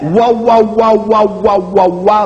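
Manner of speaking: wah with rounded lips gliding; W glide syllables; W glide demo. Shown as a demonstration. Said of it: "the bilabial velar glide".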